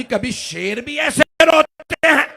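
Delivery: high, loud, slow distressed shout; loud high cry of a grieving maid; anguished cry. Acted. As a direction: cry out in disbelief. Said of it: "जनाब फिजा आ गए और एक मर्तबा कहा".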